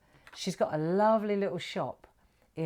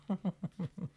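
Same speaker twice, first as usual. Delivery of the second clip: light laugh; 6 dB quieter than usual. Real, not acted. Laughs at own French.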